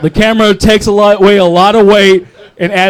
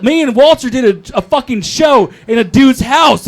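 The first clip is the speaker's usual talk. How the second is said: ordinary delivery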